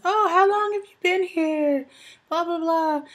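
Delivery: high, bright mock of a chatty lady. Lady at commissary retold.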